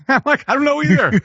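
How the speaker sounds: high and laughing through the words; answering the offended crowd with a laughing shrug; laughing reply. Retold or imitated. His own reply, laughing.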